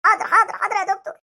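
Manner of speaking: ordinary talk, nothing marked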